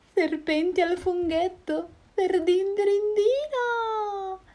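very high, slow wailing cry; horrified snake. Acted. Snake terrified of being the monster's meal, high wail. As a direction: shriek in horror, dragging it out.

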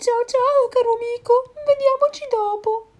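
very high, loud squawk; panicked owl farewell. Acted. Owl fleeing the Gruffalo, very high and loud. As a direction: squawk goodbye and flee.

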